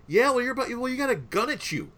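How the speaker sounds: high-pitched retold comeback; mock indignant retort. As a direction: protest in a squeaky-high imitation. Retelling gun owner's retort to kids.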